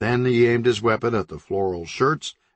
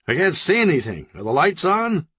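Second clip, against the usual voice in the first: higher, puzzled blind ninja; confused ninja; 8 semitones higher than usual. Acted. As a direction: grope for sight, baffled.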